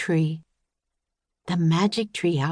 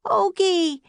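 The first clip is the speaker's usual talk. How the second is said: high loud call into the woods; shouted; 13 semitones higher, 4 dB louder than usual. A narrator's reading. Annie shouting into the woods for the puppy. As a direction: shout the dog's name. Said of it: "Annie shouted".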